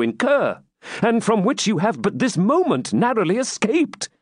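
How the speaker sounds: high, earnest remonstrance; impassioned, high-pitched plea; urgent warning. A narrator's reading. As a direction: warn your king with feeling. Knight warns king of danger.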